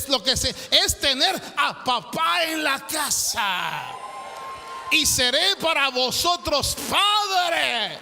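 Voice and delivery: fiery preacher crying out God's longing as a father; preaching building to a shout. Acted. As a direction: rise to a fever pitch, then stretch out Todopoderoso.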